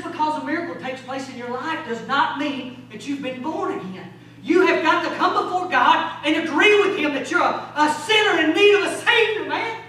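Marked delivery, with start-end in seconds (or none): 4.4-9.8 loud, high-pitched exhortation; full-force preaching. Real, not acted. Preacher urging sinners to come before God, full-force preaching. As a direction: drive it home to the whole church.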